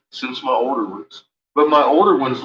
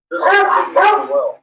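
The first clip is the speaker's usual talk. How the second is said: talking to a dog; 5 dB louder than usual. Real, not acted. Dog talk, high voice.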